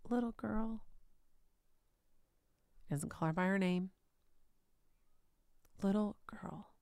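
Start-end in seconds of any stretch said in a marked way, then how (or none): 0.1-0.8 gentle whisper. Acted. Jesus speaks to dead girl. 5.8-6.7 gentle whisper. Acted. Jesus speaks to dead girl.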